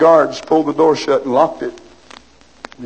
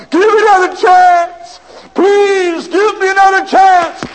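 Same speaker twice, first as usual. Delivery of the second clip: high, loud wailing plea; desperate crying plea; 13 semitones higher, 5 dB louder, slower than usual. Acted. Condemned man wailing to the governor for another chance. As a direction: beg through tears for mercy. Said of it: "he continued to cry".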